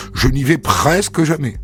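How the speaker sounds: plain reading voice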